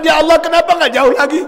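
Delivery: high, loud anguished cry; shouting in regret. Retold or imitated. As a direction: wail it out as if begging God. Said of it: "Beliau berteriak sambil mengatakan".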